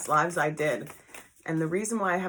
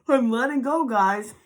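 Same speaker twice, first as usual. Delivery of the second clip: letting go; 5 semitones higher, 8 dB louder than usual. Real, not acted. Repeats release to viewers.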